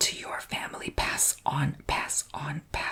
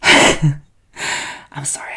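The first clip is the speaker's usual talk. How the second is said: apology through a burst of laughter; laughing; 16 dB louder, much slower than usual. Real, not acted. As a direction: laugh it out mid-whisper. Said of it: "I coughed the candle out by laughing".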